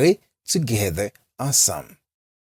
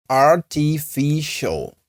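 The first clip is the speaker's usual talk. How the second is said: "Artificial" very slow and higher, ar-ti-fi-shul, with a clear t; teacher's careful, higher-pitched "Artificial", every syllable held; slow syllable breakdown; 4 semitones higher, much slower than usual. Shown as a demonstration.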